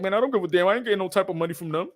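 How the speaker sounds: indifferent fan voice, rising to a loud last line; mock a careless fan who doesn't care. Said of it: "Some of y'all fans will be like".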